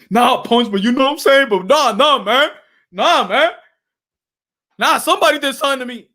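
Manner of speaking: a hint of a stung boxer, protesting; mild voiced boxer refusing the comfort; lightly voiced Wilder protesting. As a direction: push back, wounded pride. Acts Wilder in the quarrel.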